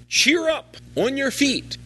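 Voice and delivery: called out high and bright to the blind man; eager call. Retold or imitated. Crowd calling to the blind man, high eager encouragement. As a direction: cheer him on, lifting the voice up high. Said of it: "So they called to the blind man".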